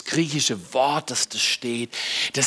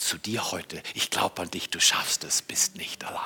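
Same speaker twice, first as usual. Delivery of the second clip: hushed, intimate whisper; 8 semitones lower, 8 dB quieter than usual. Acted. Preacher whispering the Spirit's encouragement to the congregation. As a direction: lean in and whisper it.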